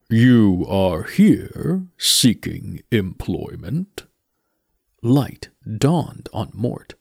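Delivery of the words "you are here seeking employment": slow, grave skeleton voice; Death's voice. Acted. Death speaks.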